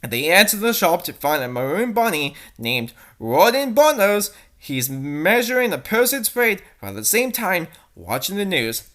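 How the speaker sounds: ordinary talk, nothing marked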